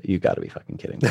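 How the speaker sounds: low, quick, muttered under the breath; muttered disbelief. Retold or imitated. To the stranger offering tai chi, a low quick disbelieving mutter. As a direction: mutter it fast like you can't believe it.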